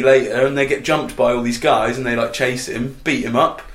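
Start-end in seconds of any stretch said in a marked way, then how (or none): none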